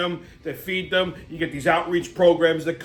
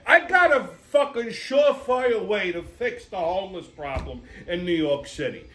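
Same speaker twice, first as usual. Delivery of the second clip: ordinary talk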